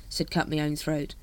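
ordinary delivery, nothing marked

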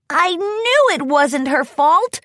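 put-on high, drawn-out boyish voice; excited Spike. A narrator's reading. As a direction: exclaim it high, vindicated. Dragon jumps up, relieved.

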